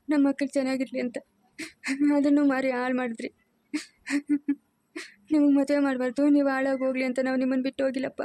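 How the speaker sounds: tearful pleading through sobs; crying. Acted. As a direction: explain yourself while weeping. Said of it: "ಅಳ್ಬಾರ್ದು".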